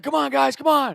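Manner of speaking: high-pitched cheering call; coach cheering. Retold or imitated. Coach cheering runners from the fence, high cheer. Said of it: "he's screaming the name".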